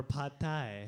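Thai pronunciation. Shown as a demonstration. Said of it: "Don't say it like that".

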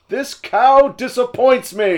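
high, slow, loud indignant outburst; Oliver Platt. Retold or imitated. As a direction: ham it up like an outraged blowhard. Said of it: "for me, it's Oliver Platt".

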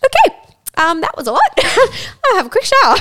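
high, laughing mock composure; laughing retold reaction. Drenched by squirt, laughing it off in a high voice. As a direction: play it dazed and giggling.

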